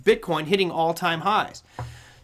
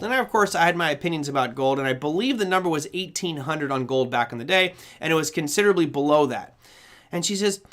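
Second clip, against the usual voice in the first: normal talking voice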